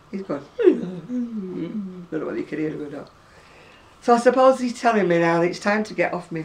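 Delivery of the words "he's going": usual speaking voice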